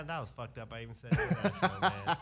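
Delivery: laughter breaking into the words; laughing at himself. Real, not acted. Host mocking his own botched intro, laughing. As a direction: crack up over your own flub.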